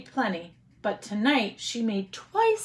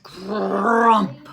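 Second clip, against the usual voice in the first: bear growl; 6 dB louder than usual. Acted. Bear grumbling at the old woman, lightly growled. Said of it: "growled Old Bear".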